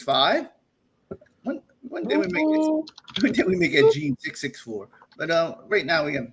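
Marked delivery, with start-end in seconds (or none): 1.4-4.9 surprised. Real, not acted. Surprised questioning, high pitch.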